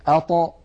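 "أط" with a bouncing release on the ط; qalqalah ṭa. Shown as a demonstration. Teacher modelling a silent letter, "أط" released with a bounce. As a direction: pop the ط of "أط" open with a short echo. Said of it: "فنقول في السكون".

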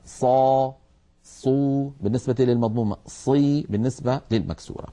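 صا, صو, صي said clearly in turn; sad vowel drill. Shown as a demonstration. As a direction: demo each vowel on sad. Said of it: "هذا بالنسبة للساكنة".

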